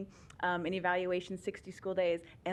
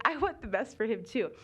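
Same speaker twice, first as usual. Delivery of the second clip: ordinary talk, nothing marked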